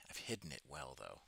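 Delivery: whispered aside about the accent; joking whisper. Real, not acted. Whispering to viewers that he hid his accent. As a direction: drop to a playful whisper.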